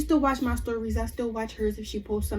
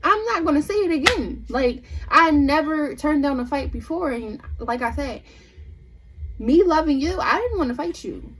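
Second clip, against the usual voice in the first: higher and louder, insistent; raised, indignant telling; heated retelling; 5 semitones higher, 5 dB louder than usual. Retold or imitated. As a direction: press the point, getting worked up. Retelling her angry plea.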